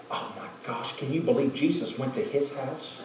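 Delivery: hushed gossipy whisper; gossiping church lady. Retold or imitated. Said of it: "I'm seeing a chick".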